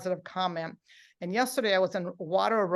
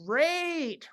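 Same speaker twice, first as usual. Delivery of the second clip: "great" stretched long on a higher pitch; drawn-out word; 7 semitones higher than usual. Shown as a demonstration. Presenter modelling reply word, "great" long and high. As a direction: hold the vowel of "great" nice and long. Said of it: "make it nice and long".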